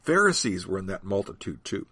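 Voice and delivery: ordinary delivery, nothing marked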